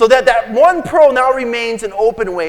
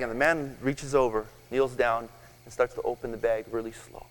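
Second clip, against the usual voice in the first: usual speaking voice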